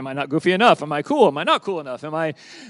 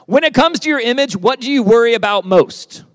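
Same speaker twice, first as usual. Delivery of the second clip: louder and higher, pressing the group; a raised, urging call to the room; emphatic question; 5 semitones higher, 8 dB louder than usual. Acted. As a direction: lean hard on "When it comes to your image". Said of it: "do you want me to repeat it".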